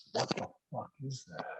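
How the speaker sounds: stretched out, very soft slow-motion retelling. Retold or imitated. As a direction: draw the words out softly, as if time slows. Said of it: "It's so slow motion".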